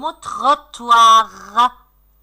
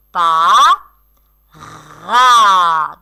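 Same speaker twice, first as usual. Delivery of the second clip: "Pa, ra" as two loud, isolated beats; syllable by syllable; 5 semitones lower, 9 dB louder than usual. Shown as a demonstration. Teacher showing the second syllable, "Pa, ra" separated. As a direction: hold "ra" long after a pause. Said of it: "Scandez le mot parapluie".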